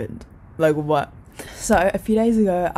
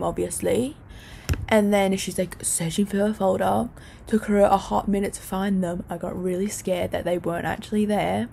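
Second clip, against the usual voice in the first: ordinary delivery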